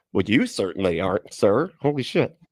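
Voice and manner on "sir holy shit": normal talking voice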